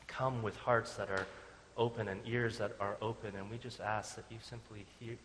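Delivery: soft, unhurried pastoral prayer. Acted. Pastor praying over the congregation, soft and earnest.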